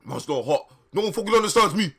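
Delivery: hammed-up emo outburst; angsty Draco. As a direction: overdo the misunderstood goth boy.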